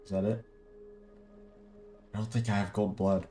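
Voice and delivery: normal talking voice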